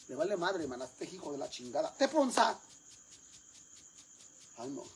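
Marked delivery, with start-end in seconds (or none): 1.9-2.6 calling out. Acted. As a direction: holler for the kid who ignores you. Mother calls out for her son.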